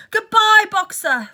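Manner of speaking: loud high call of goodbye; chorused farewell. Acted. Animals waving Boxer off, loud calling farewell. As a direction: call out cheerfully to the van. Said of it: "they chorused".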